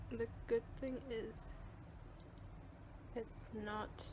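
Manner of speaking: soft, careful talk through jaw pain; with a sore jaw. Real, not acted. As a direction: speak gingerly, it hurts to talk. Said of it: "It really hurts to talk right now".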